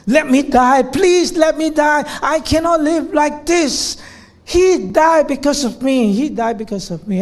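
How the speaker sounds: high, loud pleading cry; patient waking up crying out to die; calling out in despair. Retold or imitated. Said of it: "she will call out".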